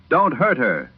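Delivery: high, pleading cry to stop the harm; cried out. A narrator's reading. Husband in the hallway begging them not to hurt her, high cry. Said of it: "Walter cried".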